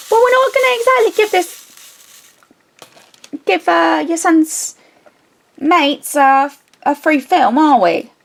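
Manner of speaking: high, loud sarcastic put-down; mock the request with sharp sarcasm; sarcastic retort. Answering the mom about the refund, high and sarcastic.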